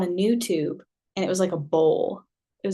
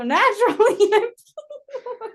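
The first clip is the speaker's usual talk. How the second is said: laughing; 13 semitones higher, 3 dB louder than usual. Real, not acted. Laughing while confessing.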